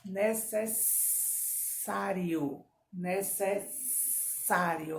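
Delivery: "Necessário" very slow, the ss a long snake hiss; slow "necessário" with a drawn sss. Shown as a demonstration. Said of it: "ó, o sonzinho da cobra".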